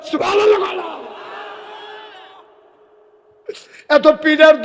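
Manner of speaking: high, loud rallying sermon; full-force preaching. Real, not acted. Preacher wishes Bangladesh had an Umar, at full force.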